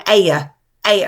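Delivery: "Aya" split as ay-uh; "Aya" with a long ay, then a loose second syllable; exaggerated two syllables. Shown as a demonstration. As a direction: show "Aya" as two distinct beats. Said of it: "sound like you're going".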